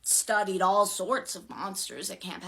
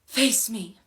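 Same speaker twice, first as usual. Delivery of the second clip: loud, raised, commanding voice; forceful command; ordering; 4 semitones higher, 7 dB louder than usual. A narrator's reading. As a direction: order an enemy to turn round. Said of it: "Piper ordered".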